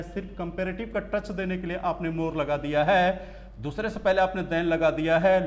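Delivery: ordinary talk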